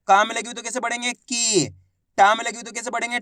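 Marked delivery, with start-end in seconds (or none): 1.1-1.7 "की" with the ई stretched; long drawn-out ī. Shown as a demonstration. Teacher giving learners an example, "की" drawn out. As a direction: hold the vowel of "की" long. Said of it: "बड़ी ई की मात्रा को खींच के पढ़ा जाता है".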